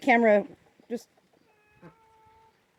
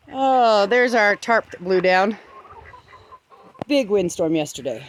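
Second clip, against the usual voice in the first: ordinary talk, nothing marked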